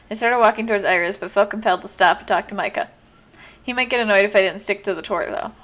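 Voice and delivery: ordinary talk, nothing marked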